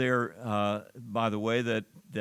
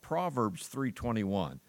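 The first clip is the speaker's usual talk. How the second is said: ordinary talk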